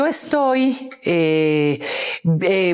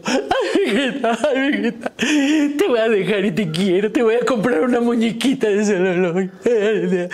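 mock crying. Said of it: "yo llorando".

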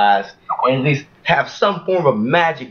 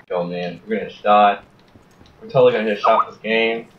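ordinary delivery

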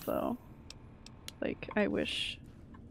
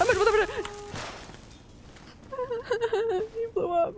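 laughing; 12 semitones higher than usual. Real, not acted. Shock as creature explodes.

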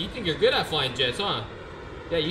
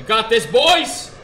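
much higher, louder rallying shout; hype call; 5 semitones higher, 8 dB louder, much slower than usual. Real, not acted. Streamer rallying his friends before the landing, loud hype shout. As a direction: pump up the squad. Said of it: "We got this, boys!".

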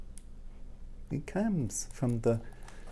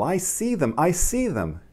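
higher, thrilled repeat; excited cry; 8 semitones higher than usual. Acted. Little mole thrilled to see the leaves, excited cry. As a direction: squeal it with joy. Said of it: "cried little mole".

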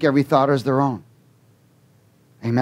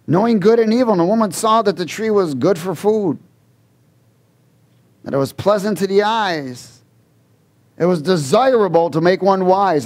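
raised, higher preaching voice driving the verses; preaching at full force; 4 semitones higher than usual. Acted. Preacher pushes the Genesis reading to the congregation, preaching. Preacher reads the fall aloud.

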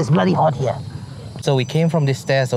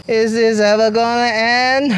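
exasperated; 6 semitones higher, 4 dB louder than usual. Real, not acted. Endless stairs, exhausted.

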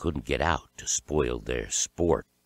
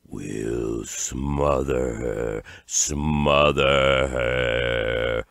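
low, very slow creeping stage whisper; whispering horrors; 4 semitones lower, much slower than usual. A narrator's reading. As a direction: draw the threat out like a crawling horror. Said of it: "whispered the crawling horrors".

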